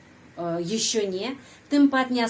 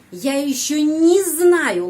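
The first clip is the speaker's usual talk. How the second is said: "не знаю" said as one unit, higher, louder and slow; linked не; 6 semitones higher, 8 dB louder, slower than usual. Shown as a demonstration. Teacher demonstrates joining не to the verb. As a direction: bind "не" tightly to "знаю". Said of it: "langsung, ya, sambung".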